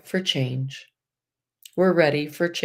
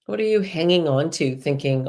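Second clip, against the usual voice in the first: ordinary delivery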